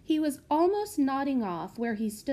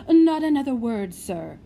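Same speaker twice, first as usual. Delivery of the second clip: loud interruption; 3 semitones higher, 9 dB louder than usual. Acted. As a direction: cut in loudly. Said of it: "interrupted Missus Spindletrap".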